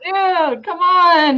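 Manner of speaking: high, loud, drawn-out plea; hammed-up excited pleading; mock excited shout. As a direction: playfully call out to rouse someone. Voices his excited wake-up call.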